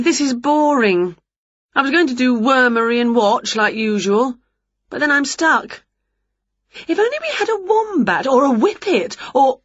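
a hint of a fed-up witch listing W words; Winnie's voice. Winnie complaining to Wilbur about her game, mildly bored.

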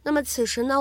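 normal talking voice

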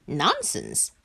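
ordinary delivery, nothing marked

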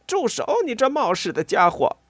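high-pitched cry of alarm; alarmed shout. A narrator's reading. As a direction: yell at a reckless friend to stop. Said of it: "莱特在座位底下喊道".

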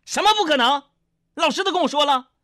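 high-pitched mock angry retort; acted indignant mum; scolding mother. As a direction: shut the son down, pitch way up. Mother angrily overrules son's denial.

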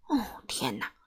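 soft, breath-filled exclamation; hushed dismay. A narrator's reading. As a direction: sigh it under your breath. Narrator reacts to hero's plight. (轻声哀叹)

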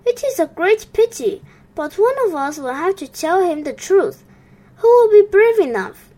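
nervous pond animals rushing their words; anxious hurried animals. A narrator's reading. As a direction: hurry through it, worried who will go. Worried animals discuss the task.

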